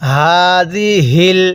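হাযিহিল with a light voiced throat h, drawn slow; throaty ha. Shown as a demonstration. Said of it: "গলার মধ্যে হালকা আওয়াজ করব".

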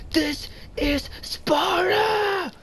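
high, slow, drawn-out war cry; Sparta movie yell. Retold or imitated. Streamer quoting the Sparta movie, high drawn-out war cry. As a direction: bellow it like the Spartan king. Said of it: "He was like".

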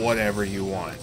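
normal talking voice

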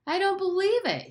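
put-on high-pitched boyish astonishment; disbelieving. A narrator's reading. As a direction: blurt it out, astonished, pitch jumping up. Astonished boy reacting in story.

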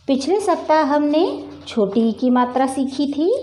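ordinary delivery

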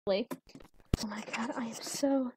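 hushed frightened whisper; breathy scared hush. Real, not acted. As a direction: whisper it, spooked. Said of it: "I got goosebumps everywhere and I'm afraid".

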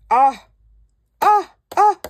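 high, loud delighted squeaks; short high squeals of joy; excited squeals. Real, not acted. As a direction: squeal like a thrilled fan. Squealing over the kit.

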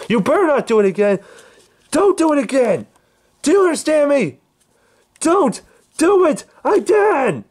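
building furious shout. Acted. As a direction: rise to a screaming warning. Escalating threat, shouting.

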